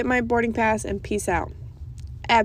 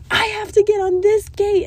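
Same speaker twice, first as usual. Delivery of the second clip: much higher, louder desperate pleading; frantic plea; 12 semitones higher, 6 dB louder than usual. Retold or imitated. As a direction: beg like you're about to miss it. Pleading with cops at security.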